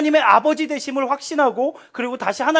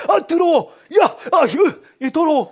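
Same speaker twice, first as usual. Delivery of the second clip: loud, high cry of disgust; disgusted yell; 6 semitones higher, 5 dB louder than usual. Pretend. Pretend dad recoiling from his son's mess, loud disgusted yell. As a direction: shriek it like the dirty diaper repels you. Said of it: "제가 이랬을까요?".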